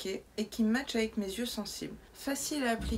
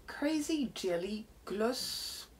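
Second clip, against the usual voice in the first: slow; much slower than usual. Real, not acted. Reading product name slowly.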